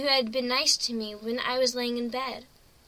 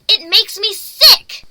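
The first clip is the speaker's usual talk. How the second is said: slow, much louder and higher cry; disgusted; 8 semitones higher, 17 dB louder, slower than usual. Acted. Pony sickened by everything human, shouting. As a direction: spit it out in disgust.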